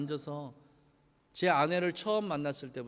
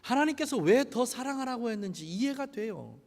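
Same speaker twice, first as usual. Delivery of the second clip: ordinary talk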